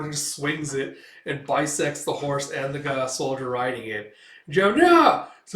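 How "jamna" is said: very loud, high slash cry; explosive battle noise; sword swing noise. Retold or imitated. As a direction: belt out the sound of a mighty strike.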